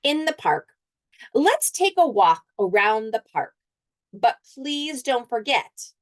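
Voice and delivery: put-on picture book read aloud to children; story reading. A narrator's reading. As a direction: read the page to the class, lively.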